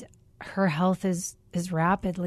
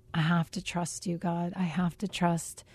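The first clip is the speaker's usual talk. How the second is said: quiet prayer; 8 dB quieter than usual. Retold or imitated. Quiet prayer spoken to God.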